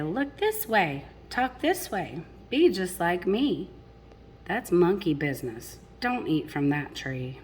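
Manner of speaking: lilting rhymed storybook read; reading to children. A narrator's reading. Parent reading a picture book to children, lilting rhyme. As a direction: read the rhymes to the little ones.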